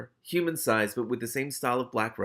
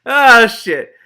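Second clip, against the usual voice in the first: high, loud burst of dismay; shocked outburst; 14 semitones higher, 20 dB louder than usual. Real, not acted. Reader reacting to Death's joke, shouting. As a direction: cry it out, caught off guard.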